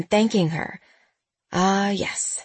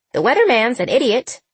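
high parrot squawk; 5 semitones higher, 3 dB louder than usual. A narrator's reading. Parrot continues.

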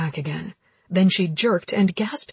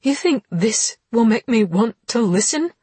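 angry; 4 semitones higher, 6 dB louder than usual. Acted. Heroine cuffed, furious.

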